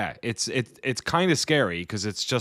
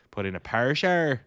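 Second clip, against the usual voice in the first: put-on Northern Irish accent; 6 semitones higher, 7 dB louder than usual. Repeats his favourite accent phrase.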